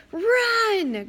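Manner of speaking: high loud bleated yell. A narrator's reading. Satyr panicking at his friends, bleating. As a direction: cry it out like a panicked goat. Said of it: "Grover bleated".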